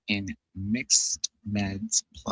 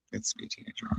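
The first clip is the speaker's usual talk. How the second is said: teasing whisper; 14 semitones higher, 10 dB quieter, faster than usual. Pretend. Teasing about daughter becoming teenager.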